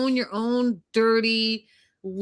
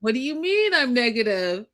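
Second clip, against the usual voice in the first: mock indignation; 4 semitones higher than usual. Podcaster mimicking the complaining husband to her co-host, mock indignant. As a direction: imitate him acting all innocent, stretching the question. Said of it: "Looking all".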